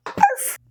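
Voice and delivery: one high yappy arf; a quick high puppy yip; single dog bark. Acted. Hidden dog keeps barking.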